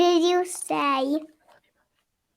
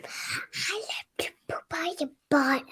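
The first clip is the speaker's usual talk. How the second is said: softer voiceless whisper; giggly whisper; 2 semitones higher, 9 dB quieter than usual. Real, not acted. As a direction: whisper it like a naughty secret. Kid whispers at goodnight kiss.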